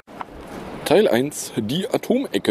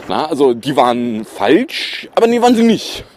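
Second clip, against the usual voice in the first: mock outrage; 3 semitones higher, 5 dB louder than usual. Podcaster mocking the operator's contradictory statements, sarcastic outburst. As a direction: throw the reversal back at them, mockingly.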